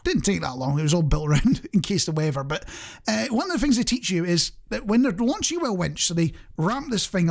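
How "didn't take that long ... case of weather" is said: normal talking voice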